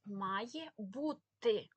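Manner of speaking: very slow, drawn-out «Має бути»; clearly articulated. Shown as a demonstration. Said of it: "Ми чітко чуємо літеру". (повільно й чітко «Має бути», виразне «т»)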